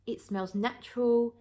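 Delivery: usual speaking voice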